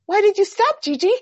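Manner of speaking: put-on high, loud child's question; little girl voice. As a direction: ask it like a startled toddler. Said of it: "all of a sudden she goes".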